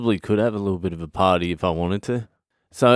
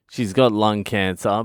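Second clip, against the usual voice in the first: ordinary talk, nothing marked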